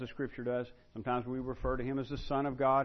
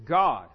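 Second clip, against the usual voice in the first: GOD punched out loud on its own; emphatic GOD; 3 semitones higher, 12 dB louder than usual. Shown as a demonstration. Teacher showing how emphatic John is, a loud stressed God. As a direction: hit the single word GOD hard. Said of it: "John is emphatic".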